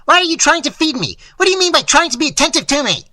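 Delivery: high, loud, fast ranting questions; furious rapid-fire shouting; enraged bawling. A narrator's reading. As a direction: demand it in a bawling rage. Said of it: "he bawled".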